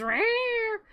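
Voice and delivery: high mock cat yowl; cat alarm yowl. Hearse cat alarm sounding, high mock yowl. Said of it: "and it goes".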